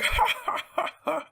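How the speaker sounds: high put-on cackle "ha ha ha ha"; mock laugh. Telling a friend about being high, fake high laugh.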